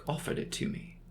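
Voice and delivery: plain reading voice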